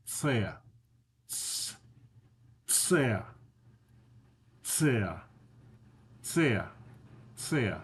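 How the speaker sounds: "ц" repeated as a sharp "ts"; ts sound drill. Shown as a demonstration. Teacher drills the ts sound for students. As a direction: drill "ц" over and over. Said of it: "You make this sound by saying".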